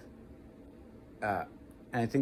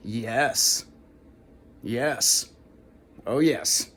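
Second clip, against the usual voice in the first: strong yes; 4 dB louder, much slower than usual. Shown as a demonstration. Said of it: "it would go like this".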